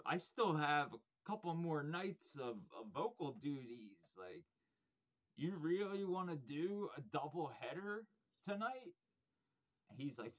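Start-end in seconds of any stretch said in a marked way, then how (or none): 5.4-8.9 incredulous. Retold or imitated. Incredulous question to drummer.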